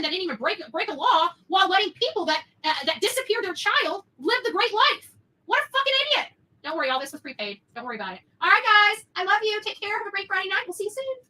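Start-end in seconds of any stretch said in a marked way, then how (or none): 5.5-6.3 much higher and louder, spitting out the insult; furious outburst. Real, not acted. Vlogger ranting to viewers about the sheriff, furious shout. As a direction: blow up at the sheriff's stupidity.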